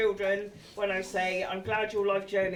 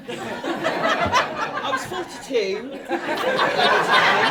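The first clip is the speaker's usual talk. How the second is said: higher, louder, laughing through it; 13 semitones higher, 9 dB louder, slower than usual. Real, not acted. Telling guests about mum's offer, laughing. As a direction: crack up at your own story.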